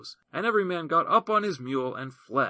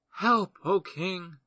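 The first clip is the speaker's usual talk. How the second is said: slow, higher plea for help; a lightly voiced widow begging the king; pleading widow; 6 semitones higher, much slower than usual. Widow falls before the king.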